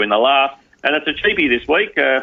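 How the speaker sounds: ordinary delivery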